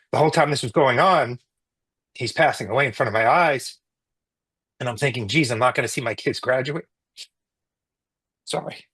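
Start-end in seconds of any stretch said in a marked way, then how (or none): 5.3-8.9 choking up. Real, not acted. Telling the interviewer about missing his kids, choked up. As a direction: voice catching with real emotion, holding back tears. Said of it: "I'm sorry, I'm getting so emotional".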